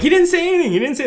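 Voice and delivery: high protesting coach voice; mock protest. Coach defends his player to the ref, mock high protest. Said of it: "tries to come over and be like".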